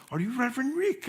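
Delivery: put-on playful Cuban-accented stranger asking. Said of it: "a man with a Cuban accent comes over".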